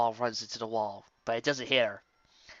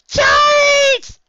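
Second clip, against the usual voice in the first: put-on very high, loud childlike shout; battle yell; 16 dB louder than usual. A narrator's reading. Pony tackling friend in snow, yelled charge. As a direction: holler it as you pounce. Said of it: "I yelled, blindly tackling".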